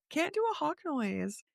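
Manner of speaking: ordinary talk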